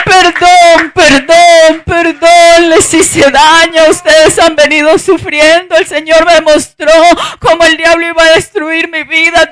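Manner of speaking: loud, impassioned retelling of a sobbing apology; retold tearful pleading. Preacher voices her daughter begging mamá for forgiveness, tearful pleading. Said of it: "La niña lloraba y lloraba".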